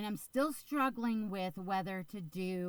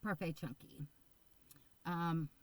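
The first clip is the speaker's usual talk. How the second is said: ordinary talk, nothing marked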